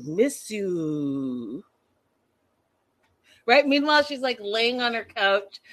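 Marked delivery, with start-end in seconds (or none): 0.0-1.6 lower, slow spooky voodoo voice. Retold or imitated. Mocking the couple's texts as spooky voodoo. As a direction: drone it like a spell. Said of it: "Like voodoo".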